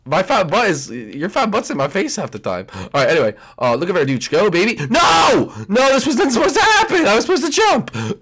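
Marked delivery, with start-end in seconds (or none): 4.9-7.9 dismayed shout. Real, not acted. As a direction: yell no at the failed jump. Fails jump in game.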